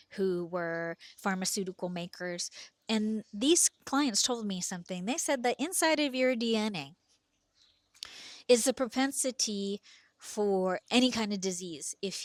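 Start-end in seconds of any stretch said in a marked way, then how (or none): none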